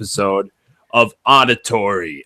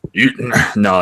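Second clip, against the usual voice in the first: usual speaking voice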